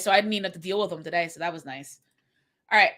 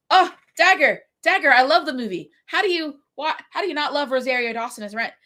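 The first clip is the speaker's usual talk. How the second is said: higher and louder, gushing over the movie; excited gushing; 5 semitones higher, 4 dB louder than usual. Retold or imitated. Telling the podcast host she loves the movie, excited and loud. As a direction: burst out in delighted protest.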